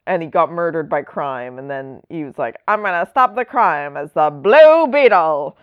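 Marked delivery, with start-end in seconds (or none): 2.7-5.6 louder hammed-up hero vow; mock-heroic proclamation; old-timey hero. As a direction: declare it like an old-timey superhero. Acts out old-timey hero's vow.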